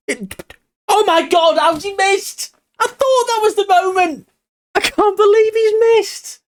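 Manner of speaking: very high, strained cry of disbelief; can't believe what he just saw; shocked disbelief. Real, not acted. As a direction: after his striker misses the decisive chance, aghast. Striker misses a sitter.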